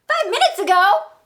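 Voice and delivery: can't believe it, echo her words; Gerald incredulous. Acted. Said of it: "When I say the Gerald part".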